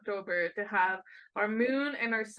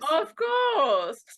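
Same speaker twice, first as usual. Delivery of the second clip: loud, high mock shout; shouted echo; 8 semitones higher, 8 dB louder than usual. Echoing the echo back in a loud playful shout.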